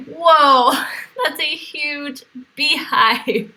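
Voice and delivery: ordinary talk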